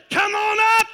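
loud, high shouted summons; crying out. Acted. Preacher voicing the returning Lord calling his people, crying out. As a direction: shout it up like a call from the clouds. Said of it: "He's gonna cry out for his people".

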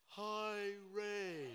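high, loud, drawn-out slow-motion greeting. Retold or imitated. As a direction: stretch each word as if time slowed down. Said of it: "it was like slow motion".

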